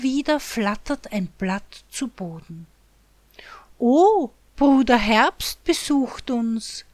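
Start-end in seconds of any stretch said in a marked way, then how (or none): 3.8-6.9 excited call. Acted. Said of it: "ruft Wichtelchen".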